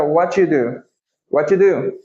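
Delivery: "What you do" with T and you merged into "watchu"; T and you joined. Shown as a demonstration. Teacher shows students the fastest "What you do". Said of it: "A gente ignora esse do".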